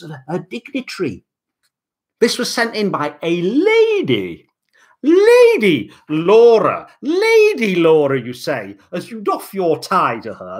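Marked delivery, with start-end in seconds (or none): 5.0-8.2 slow, louder, stately herald; grand announcement. Pretend.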